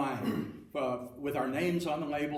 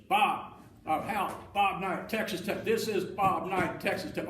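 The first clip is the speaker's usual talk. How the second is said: louder, higher, drawn-out coach's voice; Bob Knight; 5 semitones higher, 4 dB louder, slower than usual. Retold or imitated. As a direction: repeat your name insistently in an imitated voice. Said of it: "that is a pretty good imitation".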